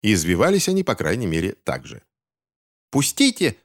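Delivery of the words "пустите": high-pitched acted yell of protest. A narrator's reading. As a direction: cry out to be let go. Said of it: "вопил Финч". (высокий отчаянный вопль)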